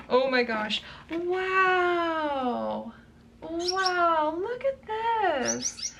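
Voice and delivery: breathless high wonder, drawn out slowly; high, slow gasp of delight; awed. Real, not acted. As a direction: stretch it out, stunned by how pretty it is. Awed by unboxed print.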